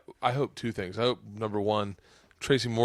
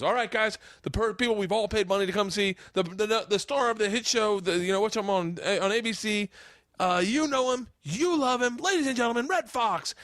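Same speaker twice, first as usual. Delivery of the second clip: raised, building emcee introduction; stage announcer; 7 semitones higher than usual. Retold or imitated. Opener finally introducing the headliner to thirty people, stage announcer. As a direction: build it up like a show host. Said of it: "and he goes".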